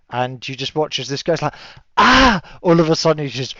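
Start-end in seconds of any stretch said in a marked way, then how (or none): 2.0-2.4 loud, high startled yelp; startled cry. Acted. GM voicing the enemy crying out as he's hit.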